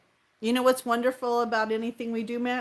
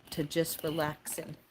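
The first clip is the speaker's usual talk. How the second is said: ordinary delivery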